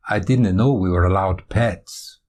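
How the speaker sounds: slow Scottish remark to a neighbour; unhurried Scottish voice; Scottish accent. Acted. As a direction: take it slowly in a Scottish accent. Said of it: "Is that a Scottish accent".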